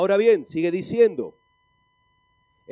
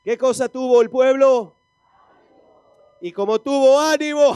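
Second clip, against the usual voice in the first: raised, high-pitched preacher's shout; loud rousing call; 7 semitones higher, 11 dB louder than usual. Real, not acted. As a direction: fire the question out to the whole congregation.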